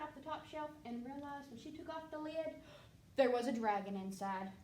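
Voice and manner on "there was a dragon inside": ordinary delivery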